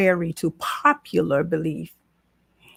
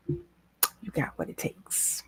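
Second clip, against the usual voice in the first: whisper; 6 semitones lower, 13 dB quieter, slower than usual. Real, not acted. Closing encouragement, whispered.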